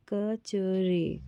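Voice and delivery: कचरे said very slowly, syllable by syllable; teacher sounding out कचरे for children. Shown as a demonstration. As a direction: stretch कचरे out so each sound is heard. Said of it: "रा में ए की मात्रा लगी है तो रे".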